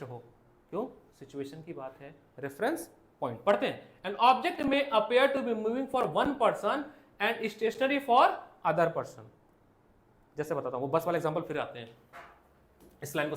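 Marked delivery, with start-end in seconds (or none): 4.0-9.2 reading the NCERT line aloud word by word; drawn-out dictation to the class; slow textbook reading. Shown as a demonstration. Teacher reading the textbook line slowly to students.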